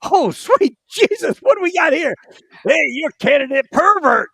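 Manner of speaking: mocking platoon sergeant. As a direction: ham up the sergeant's disgust. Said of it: "you hear the platoon sergeant".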